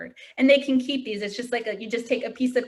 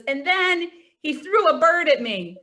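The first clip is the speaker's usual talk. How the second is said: earnest, raised student quote with the mixed-up idiom; innocent student; 6 semitones higher than usual. Retold or imitated. Student telling the librarian what happened, sincerely. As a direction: play it straight, sincere and a bit higher. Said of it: "and he goes".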